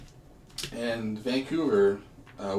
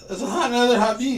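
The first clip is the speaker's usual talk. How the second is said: laughing; 14 semitones higher, 11 dB louder, faster than usual. Real, not acted. Refusing the bean, laughing.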